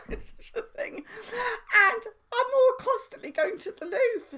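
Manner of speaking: high, giggling laughter through the words; laughing. Real, not acted. Confessing diet toilet trips, giggling high. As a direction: dissolve into giggles as you speak.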